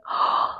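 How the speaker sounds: soft retold gasp of shock; a quick shocked intake of breath; mock gasp. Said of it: "I was like".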